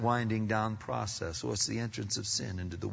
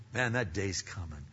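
ordinary delivery

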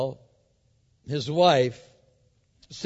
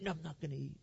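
soft mock sulk, lightly childish; pouting; 12 dB quieter, much faster than usual. Ahab refusing his wife's call to eat, mock pouting. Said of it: "pouting and whining like a baby".

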